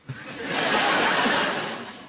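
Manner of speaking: high breathy burst of laughter. Real, not acted. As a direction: laugh at the memory. Baby pees, he laughs.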